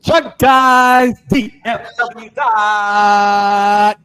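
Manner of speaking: loud, stretched-out brand call; hype intro shout. Acted. Host opening the show to viewers, loud drawn-out hype call. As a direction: belt the channel name like an announcer.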